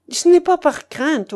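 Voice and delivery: loud, high, impassioned protest; heated exclamation. A narrator's reading. Vicar denying he leaves from fear, heated exclamation. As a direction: burst out hotly in denial. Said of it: "s'écria-t-il d'un visage enflammé".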